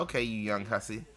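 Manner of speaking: put-on low, soft old-lady retort; muted, low mock-elder comeback; resigned old lady. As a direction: imitate an old lady, dropping quiet. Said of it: "the old lady be like".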